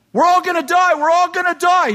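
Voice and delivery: shrieked high and loud, over and over; screaming. Acted. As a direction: yell it in full panic. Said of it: "the man in front starts screaming".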